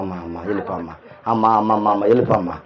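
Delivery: mocking a meek, soft plea to the spirit; timid pleading. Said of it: "ప్రార్థన చేయకుండా వెళ్తారు కొందరు". (బలహీనంగా, మెత్తగా వేడుకోవడం అనుకరణ)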